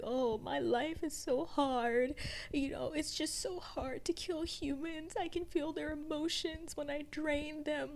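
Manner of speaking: mocking whiny Louis. Armand mocking Louis' angst over killing, high whine. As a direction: mimic him to make fun of his angst. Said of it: "he's just mimicking him".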